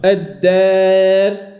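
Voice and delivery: الدار drawn very slowly, alif leaning toward e; imala in recitation. Shown as a demonstration. Teacher recites examples of imala. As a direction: stretch الدار long with alif bent to e. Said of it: "كذلك".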